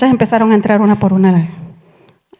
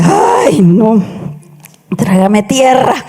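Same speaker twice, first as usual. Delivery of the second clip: mock dismay; much slower than usual. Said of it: "Y yo dije".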